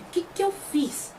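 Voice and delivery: a baffled little aside to oneself; bewildered. Retold or imitated. The chief left dumbfounded after Tien storms off, bewildered.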